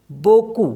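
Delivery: बोकू said with no P, boh-koo; French word. Shown as a demonstration. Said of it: "पी जो है इसमें silent होता है". (पी को बिना बोले बोकू)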